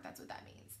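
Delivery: whispered aside; whispered explanation. Real, not acted. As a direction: breathe the words, voiceless.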